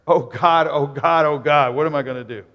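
loud, acted panicky prayer; terrified prayer. Preacher as terrified Nathaniel praying before the crowd, mock panic. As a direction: pray in mock panic, rushing at the end. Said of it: "like, I'm terrified".

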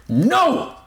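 high, desperate yell; shouted back. A narrator's reading. Narrator shuts out the monster's urging, shouting back. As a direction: shout it out to silence him. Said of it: "shouted back".

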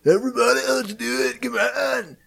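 high, strained mock yell, held back in volume; hyped-up bro, pitched high; obnoxious bro. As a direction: imitate a broey guy rallying everyone. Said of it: "just starts shouting lines from".

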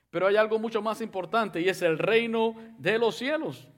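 normal talking voice

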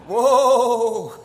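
loud, high, drawn-out whoa; hammed-up shock, stretched out loud; startled whoa. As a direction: blurt a big comic whoa of alarm. Usher's startled thought.

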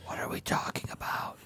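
whispered over their shoulders; sneaky whisper. Pretend. Player sneaking up on the Dragonborn, whispering. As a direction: hiss it sneaking up. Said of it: "sneak up behind him and be like".

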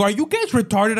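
a mild mock yell at airport staff; retold outraged yell. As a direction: throw it at them, disbelieving. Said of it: "I started screaming".

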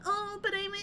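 high, whimpering fake sob; hammed-up crying voice; mock crying. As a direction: pretend to cry through the words. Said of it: "That's me crying".

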